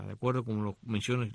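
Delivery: usual speaking voice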